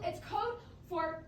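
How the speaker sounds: ordinary talk, nothing marked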